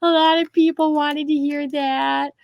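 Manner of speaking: high, slow cartoon voice; goofy high cartoon impersonation; Carl Wheezer. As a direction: talk in a drawn-out, high-pitched character voice. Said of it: "I did the Carl Wheezer thing".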